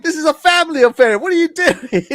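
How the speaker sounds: high-pitched, loud indignant quote, close to laughing; mock outrage. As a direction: act out the affronted family. Acting out offended family in retold scene.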